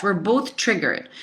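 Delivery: normal talking voice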